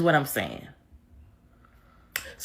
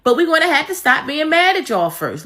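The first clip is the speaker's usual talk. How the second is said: ordinary talk, nothing marked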